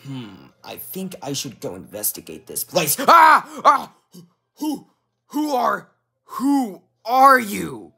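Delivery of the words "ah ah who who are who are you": high shrieks, then halting question; frightened stammer. Acted. Knight meets his double, frightened and stammering. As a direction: stammer high in shock, stretching the last question.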